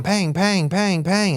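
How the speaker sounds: mouthed gunshots, pang pang; quick mock gunfire sounds; gunshot noises. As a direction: imitate shots ringing out. Imitating gunshots chasing him.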